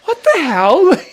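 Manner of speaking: fed up as rugby keeps coming back; exasperated what the hell. Retold or imitated. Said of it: "I'm like".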